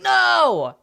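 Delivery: loud, high, drawn-out wail; mock outraged listener. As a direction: wail it in exaggerated horror. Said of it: "you're like".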